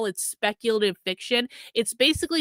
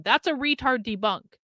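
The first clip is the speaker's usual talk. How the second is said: ordinary delivery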